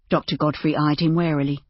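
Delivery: ordinary delivery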